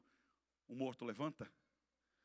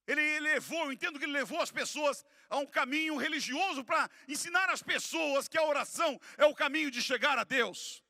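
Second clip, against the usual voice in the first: high, loud, driving explanation; fervent preaching; 12 semitones higher, 11 dB louder than usual. Real, not acted. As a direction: preach the point with mounting force.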